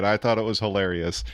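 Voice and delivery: normal talking voice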